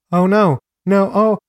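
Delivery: high, loud distressed cries; acted crying shout. A narrator's reading. Narrator voices victim seeing the hammer, acted crying shout. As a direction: cry out high in protest. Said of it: "begins crying and shouts".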